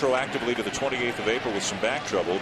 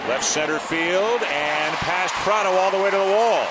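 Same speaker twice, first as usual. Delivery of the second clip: louder, higher, stretched-out call of a big hit; excited play-by-play; 8 semitones higher, 5 dB louder, slower than usual. Real, not acted. Live call of a double.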